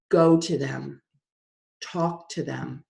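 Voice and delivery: low, measured, commanding inner voice; voice she hears. Retold or imitated. Inner voice sending her to a grieving family, low and measured. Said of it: "This is what I hear".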